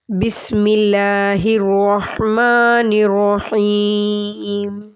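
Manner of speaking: slow, drawn-out tajweed chant; measured Quranic recitation. Acted. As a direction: recite it melodically, stretching the vowels.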